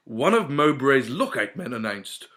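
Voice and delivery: plain reading voice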